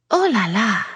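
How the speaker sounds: high, playful French Oh la la. A narrator's reading. Yasmin sounding very French to Cleo and Jake. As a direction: sing out Oh la la like a Parisienne. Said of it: "sounding very French".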